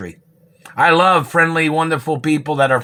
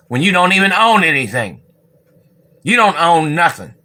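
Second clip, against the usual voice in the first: higher, louder scornful retort; indignant outburst; 5 semitones higher, 4 dB louder than usual. Real, not acted. Telling the government people they own nothing, indignant and loud. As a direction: spit it back at them. Angry rant at agents.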